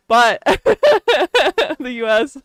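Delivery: slow words broken by laughter; laughing. Real, not acted. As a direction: laugh through it. Laughing mid-sentence.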